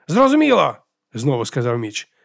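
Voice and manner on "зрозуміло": plain reading voice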